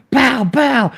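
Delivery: put-on high goofy sound effects from a drunk; mock drunk friend smacking the driver. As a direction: squeal it like a hammered buddy playing. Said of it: "he's like".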